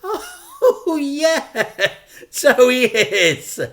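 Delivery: high, loud, slow laughing admission; nervous laugh. Acted. Dad is caught out about his son, a loud high nervous laugh. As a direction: laugh it off awkwardly.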